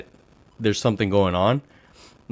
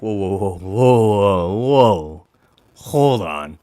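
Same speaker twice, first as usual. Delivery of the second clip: drawn-out mock "whoa, whoa", swelling louder; acted-out objection; 4 dB louder, much slower than usual. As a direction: act out halting someone who got personal. Acting out his objection when it turns personal.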